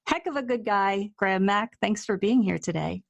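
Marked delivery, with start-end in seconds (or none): none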